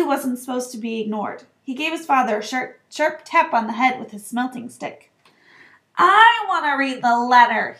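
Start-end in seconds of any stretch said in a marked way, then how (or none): 6.0-7.8 loud, demanding spoilt son; loud, insistent whine; loudly. A narrator's reading. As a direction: demand it at full voice. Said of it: "he said loudly".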